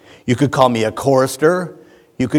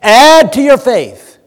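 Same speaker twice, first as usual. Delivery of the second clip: "add to your faith" slow, loud and higher, ADD hit hard; emphatic reading; 8 semitones higher, 6 dB louder, slower than usual. Shown as a demonstration. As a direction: land "add" heavily, drawing each word out. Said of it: "Peter wants you all excited".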